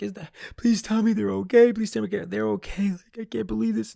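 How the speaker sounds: high, loud frantic begging; desperate pleading. Retold or imitated. Begging the healer that his family is okay, frantic.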